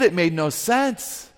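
ordinary talk, nothing marked

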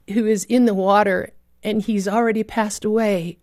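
ordinary delivery

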